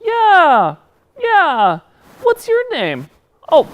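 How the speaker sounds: high, loud excited puppet. Pretend. Puppet greeting the host, high and eager. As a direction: squeal it as an eager little puppet.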